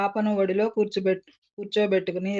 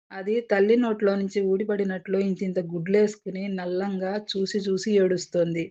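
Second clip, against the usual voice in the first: plain reading voice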